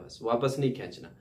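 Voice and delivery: usual speaking voice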